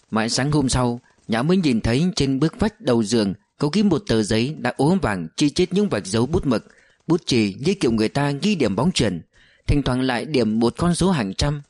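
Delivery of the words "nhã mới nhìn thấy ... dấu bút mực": ordinary delivery, nothing marked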